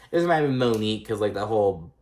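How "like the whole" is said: ordinary delivery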